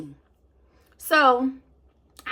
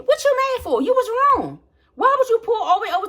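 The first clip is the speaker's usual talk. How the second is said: much higher, incredulous rant; 10 semitones higher, 4 dB louder than usual. Real, not acted. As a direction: scoff at his anger. Ranting about rude driver.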